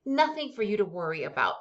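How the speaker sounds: usual speaking voice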